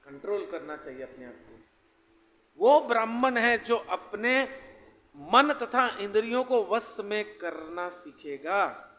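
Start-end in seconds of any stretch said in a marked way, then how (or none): 2.6-8.9 loud, slow, raised declamation; formal scripture reading. Acted. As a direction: read it out slowly and forcefully to a congregation. Reads out a definition. (ऊँची आवाज़ में धीमा शास्त्र-पाठ)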